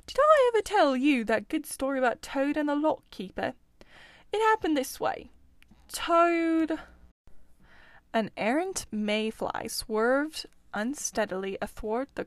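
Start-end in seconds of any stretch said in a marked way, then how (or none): 0.0-6.8 higher, louder, eager animal voice; storytelling Otter. Acted. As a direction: launch into an anecdote, higher and louder. Otter begins telling a story.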